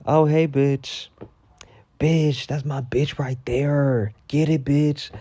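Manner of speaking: put-on slow, drawn-out cheering for a girl; hyped-up friend. Shown as a demonstration. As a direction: cheer her on like a proud friend. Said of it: "a good bitch would be like".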